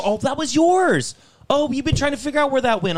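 lightly voiced ditzy manager; mock clueless manager. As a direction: play the manager as dimly surprised.

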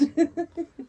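sudden high nervous giggle; awkward high laugh. Real, not acted. Laughing awkwardly while her friend describes crying. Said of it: "I laugh and I'm awkward".

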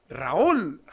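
a loud retold call across the station; calling out a name. Spotting him on the escalator, calling out loudly. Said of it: "y lo digo".

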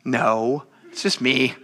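ordinary talk, nothing marked

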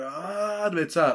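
ordinary talk, nothing marked

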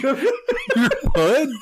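incredulous laughing question. Real, not acted. Baffled by the slang.

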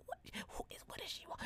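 hushed whisper; whispered aside. Retold or imitated. Sister whispering in the car about the stranger, hushed. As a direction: whisper so the stranger can't hear.

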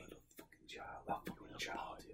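whispered insults, breathy and close; playful ASMR whisper trading insults with a friend; mock ASMR whisper. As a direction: hiss it like a joke ASMR video. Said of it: "That's enough A S M R".

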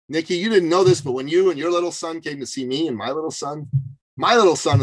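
ordinary talk, nothing marked

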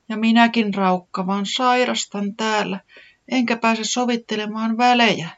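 high, whiny complaint of a sick little one; whimpering lament. A narrator's reading. Sick Tiputi moaning to herself, high and whiny. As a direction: moan it from the sickbed. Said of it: "se vaikersi".

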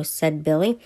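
ordinary delivery

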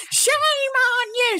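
very high, slow mock scold; old librarian scolding. Boy mimicking the librarian who caught a kid damaging a book, high mock scold. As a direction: stretch it out in a prissy old-lady squeak. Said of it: "She said it, like, two or three times".

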